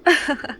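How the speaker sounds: short burst of loud amused laughter. Acted. Mother laughing at her son's worry about the cat, loud.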